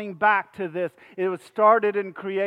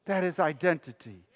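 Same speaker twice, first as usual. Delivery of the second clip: lower, softer echo of the point; hushed repeat; 5 semitones lower, 7 dB quieter than usual. Real, not acted. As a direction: let it sink in quietly.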